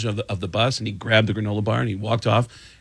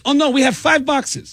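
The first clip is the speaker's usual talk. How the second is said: high-pitched mock-cheery dismissal; blithe reassurance; 3 dB louder than usual. Wife refusing roadie a shower, mock-reassuring and high. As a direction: wave the worry away in a high voice.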